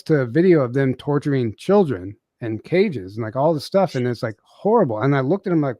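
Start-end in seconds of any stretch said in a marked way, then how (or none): none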